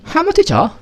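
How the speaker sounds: put-on high, bright Harry Potter British accent; playful British accent impression. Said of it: "Pernah nonton Harry Potter nggak?".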